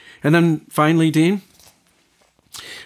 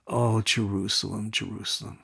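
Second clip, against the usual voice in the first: soft, low, sorrowful lament over the city; a hint of tears; mild weeping lament; 4 semitones lower, 9 dB quieter than usual. Jesus weeping over Jerusalem, softly lamenting. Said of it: "he's weeping over the city".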